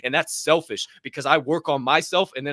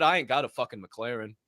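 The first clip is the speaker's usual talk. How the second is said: normal talking voice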